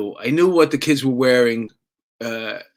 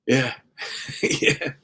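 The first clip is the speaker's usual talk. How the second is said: timid whispered yeah, half laughing; scared whisper; 6 dB quieter than usual. Retold or imitated. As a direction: whisper it like a kid caught drinking by the principal. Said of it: "We're scared, so scared".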